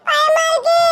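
high frightened cry to his aunt; scared plea. Acted. Nephew pleads with his aunt, high and scared. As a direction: beg her, scared, to stop the music.

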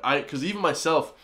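usual speaking voice

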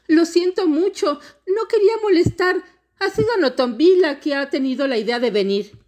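put-on high-pitched, loud childlike pleading; little girl apologizing. A narrator's reading. Smallest girl apologizing to the river monster, pleading. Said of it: "dijo la niña más pequeña".

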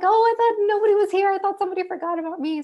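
high, fast, tearful wail; hysterical crying. Retold or imitated. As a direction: retell the sobbing panic to the nurse. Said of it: "just in hysterics, crying".

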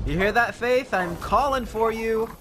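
loud high call across the field. Real, not acted. Gamer calling loudly to his distant dog. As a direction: call out to her from afar.

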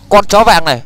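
loud call of Con chó vàng này; calling the dog. Real, not acted. Yelling at the yellow dog.

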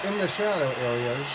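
talking with a paintbrush in mouth. Real, not acted. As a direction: mouth full of paintbrush, words muffled. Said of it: "sorry, I got a paintbrush in my mouth".

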